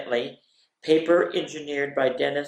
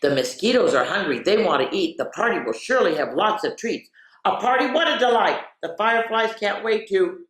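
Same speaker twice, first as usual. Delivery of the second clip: bright, lively storybook voice; excited picture-book reading; reading to children; 4 semitones higher, 3 dB louder than usual. Acted. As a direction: read it like a party is coming.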